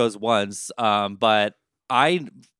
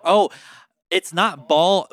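usual speaking voice

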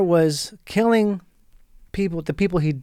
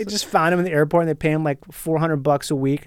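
usual speaking voice

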